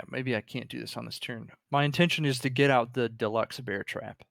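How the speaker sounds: ordinary delivery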